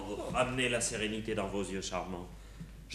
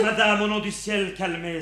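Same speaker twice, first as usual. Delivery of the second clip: hammy stage actor; 9 semitones higher, 8 dB louder than usual. Acted. Pleading in stage verse.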